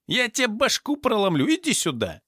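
high, heated threat; acted furious threat. A narrator's reading. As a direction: pitch it high, spoiling for a fight. Thug threatens to attack. (яростная угроза на высоких тонах)

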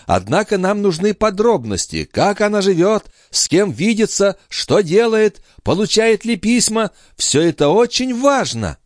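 high-pitched lady listing demands; eager countess. Acted. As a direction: press urgently, in a high voice.

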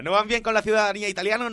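high, quick mock indignation; mocking repeat. Host scoffing at Monti's claim, mock indignant.

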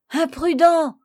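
high, loud rebuke; sharp cry of alarm; alarmed exclamation. A narrator's reading. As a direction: shout up at a reckless climber. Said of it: "s'écria monsieur de Rugès".

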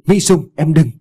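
high, loud desperate call; husband calls after his fleeing wife, loud and high. A narrator's reading. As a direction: call after her as she runs. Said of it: "Anh kêu lớn".

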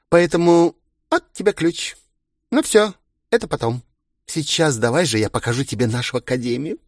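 put-on high, chirpy, busy girlish voice; giddy party girl. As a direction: chatter like an eager hostess. Староста выбирает комнату. (щебечущая бойкая девчонка)

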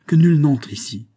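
loud, high urgent command; exclamation. A narrator's reading. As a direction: cry out the order. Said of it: "s'écria-t-il". (ordre lancé d'une voix forte)

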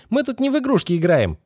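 high-pitched indignant outburst; boiling over. A narrator's reading. Girl startled by the boy's head, boiling over. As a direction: flare up sharply, pitch shooting up. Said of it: "вскипела Китти".

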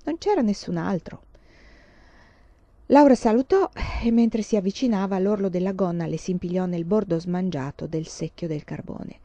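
plain reading voice

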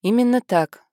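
usual speaking voice

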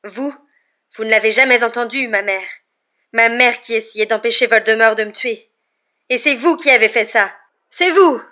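high, impassioned angry outburst; trembling fury. A narrator's reading. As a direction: build from pained reproach to yelling. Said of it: "d'une voix qui tremblait de plus en plus".

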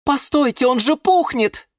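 high-pitched alarmed cry; exclaiming. A narrator's reading. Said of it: "воскликнула Розмарин Марина". (тревожное восклицание высоким голосом)

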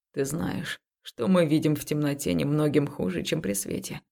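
low, hushed male voice; soft low man's voice. Acted. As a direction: speak low and intimately in the dark. Man speaks softly in the dark.

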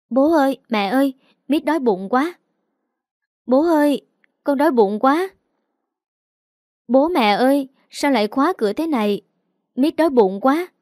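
put-on high child voice calling through a locked door; a hungry little girl shouting for mom and dad; child calling out loudly. A narrator's reading. Little daughter knocking on bedroom door, calling loudly in a high child voice. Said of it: "nó vừa gõ cửa vừa nói to".

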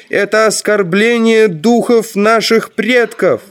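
high-pitched indignant harangue; relentless protest. A narrator's reading. Ferl objects to sinful pseudo-gold before the ruler, indignant protest. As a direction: press the accusation hard in a high voice. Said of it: "не унимался Ферл".

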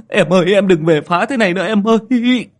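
high, loud desperate begging; frightened pleading. Acted. Mẹo praying to his sister's ghost, frantic pleading. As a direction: implore a spirit to stop.

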